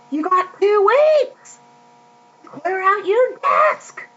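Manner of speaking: high-pitched mock boss voice; mock firing announcement. Acting out a company firing its employee, high mock announcement.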